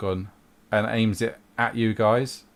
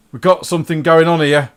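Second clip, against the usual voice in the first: raised, louder cultist voice; calling out in character; 7 semitones higher, 10 dB louder than usual. Acted. Cult member alerting his companions, calling out. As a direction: call it out high and loud, like a henchman.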